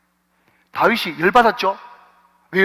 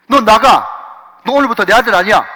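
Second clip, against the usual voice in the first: mock angry father; 2 semitones higher, 7 dB louder than usual. Preacher voices angry father.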